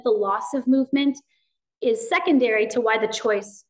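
ordinary delivery

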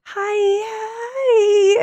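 high, squeaky mock-timid Kyle; imitating Kyle. Mocking how Kyle greeted her, in a high squeaky voice. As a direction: play Kyle small and nervous, way up high. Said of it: "poor Kyle's like".

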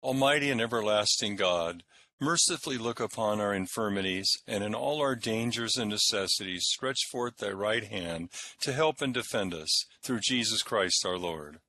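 solemn prayer voice; liturgical prayer. Acted. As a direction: lead the prayers in church. Intones versicles and collects.

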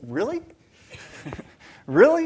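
incredulous, pitch swooping sharply up; shocked disbelief. Retold or imitated. Preacher recalling his shock at a response, incredulous. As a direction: react as if you can hardly believe it. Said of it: "I was so shocked by it".

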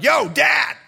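loud, high, cheeky "Yo, Dad!" shout; imitating his kids. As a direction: call out to dad like a careless teen. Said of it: "They're like".

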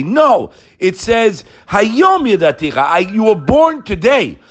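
preacher insisting on the verse; fervent emphasis. Real, not acted. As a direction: lean hard into it. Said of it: "preaching emphasis".

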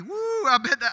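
hammed-up euphoric cheer; mock high. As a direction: whoop like someone high. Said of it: "You're gonna be".

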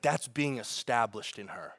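soft, low, slow and gentle; God gently speaking to the pastor, soft and low; gentle whisper of God. Retold or imitated. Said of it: "God whispered to me just so gently".

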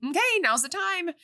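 high, eager announcement; teachers' call. Retold or imitated. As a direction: summon her to the fight. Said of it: "they're like".

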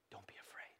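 hushed whisper into soft, low voice. Acted. God reassuring his despairing child, whisper easing into soft low speech. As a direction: reassure very softly, starting in a whisper. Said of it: "we should hear him whisper to us".

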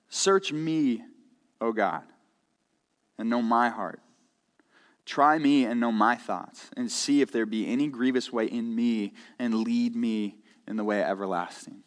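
quiet, unhurried scripture reading; reverent scripture reading. Acted. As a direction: read it low, as a prayer. Reads closing psalm verse reverently.

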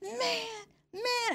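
put-on high, drawn-out teen whine; whining young teenager. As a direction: imitate a kid protesting in a high whine. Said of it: "when I was a young teenager".

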